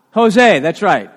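ordinary delivery

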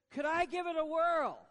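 loud, high-pitched eager plea; eager mock shout. Peter calling to Jesus across the water, eager shout. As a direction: call it out like a keen volunteer. Voicing Peter asking to try.

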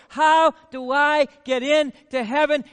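loud, slow, pleading question; shouting up to Jesus, slow and loud; rich young ruler asking. Retold or imitated. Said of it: "That's what he said to the rich young ruler".

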